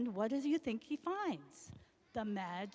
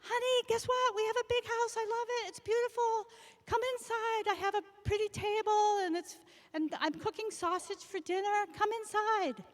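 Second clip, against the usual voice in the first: much higher, thrilled wife voice; delighted wife; 9 semitones higher than usual. Acted. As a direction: gush over the new house. Delighted wife greets husband.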